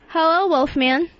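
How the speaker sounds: ordinary talk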